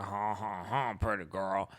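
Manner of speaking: ogle her with a lazy laugh; hammed-up creepy officer; leering cop. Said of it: "And then the officers are like".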